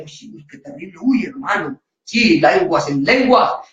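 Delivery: hushed, awed gasping murmur; an awed churchgoer gasping at the tongues; mock awe. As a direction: play the impressed churchgoer, breathless. Mocking awed congregation.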